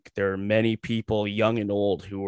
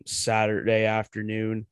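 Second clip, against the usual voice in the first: ordinary talk, nothing marked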